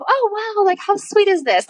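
high, cooing retold amazement; touched wonder. Retelling her touched reaction to a sign.

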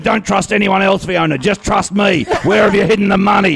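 high, pressing interrogation; mock urgent pleading. Retold urgent questioning of Fiona.

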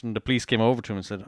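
ordinary talk, nothing marked

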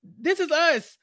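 high, loud, sassy comeback at a lady; mock confrontation. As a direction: tell her off, half laughing. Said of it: "you should have flipped the table and be like".

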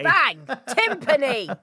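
punchy mock-instant answer; imitating a snap quiz answer; instant confident answer. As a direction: fire it out like a sure thing. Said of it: "you knew that straight away".

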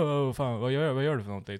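mildly mock a fussy customer calling out; imitated calling out. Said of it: "det börjar ropa".

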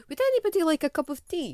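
high, bright hostess offer; offering tea. Retold or imitated. Housemate breaking up an argument, high chirpy tea offer. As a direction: sing out the tea offer to calm a row. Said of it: "I would go".

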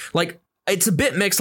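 normal talking voice

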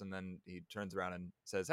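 normal talking voice

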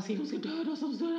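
high, loud panicked screaming, words tumbling out; hysterical screaming. Retold or imitated. A girl screaming to her parents after a death threat, hysterical panic. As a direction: scream it in terror as a child. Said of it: "bara skrek, skrek, skrek".

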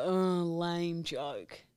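slow, flat, unimpressed. Retold or imitated. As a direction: drag it out bored. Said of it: "would probably go".

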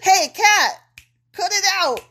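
high, loud telling off; scolding shout. Real, not acted. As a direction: yell at the naughty cat. Scolding the cat.

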